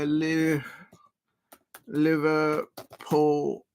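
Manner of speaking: Liverpool stretched very slowly in a mock Liverpool accent; bad Liverpool accent. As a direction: drag out Liverpool in a poor Liverpool accent. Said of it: "very bad Liverpool accent".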